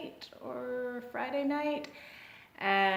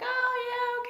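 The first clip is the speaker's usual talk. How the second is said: put-on girl's voice. Girl voiced by narrator.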